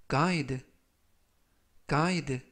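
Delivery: "guide" spoken clearly with a long i and a light final d, falling; careful pronunciation demo. Shown as a demonstration. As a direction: model "guide" for learners. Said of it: "guide라고 발음하시면 되겠고요". ("guide" 또박또박 발음 시범)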